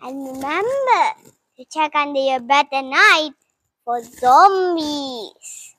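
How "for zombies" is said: slow spooky drawl. Acted. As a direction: stretch it out slowly and eerily. Playful scary warning.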